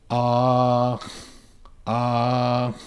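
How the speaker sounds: long ah, then breath through the nose. Shown as a demonstration. Teacher breathes through the nose after ah. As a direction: hold ah and release air through the nose. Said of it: "I breathe through my nose after a long R".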